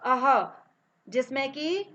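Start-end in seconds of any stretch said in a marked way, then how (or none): 0.0-0.5 अह with a breathy h at the end; aspirated visarga sound; visarga demo. Shown as a demonstration. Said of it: "जिसे बोलते हैं".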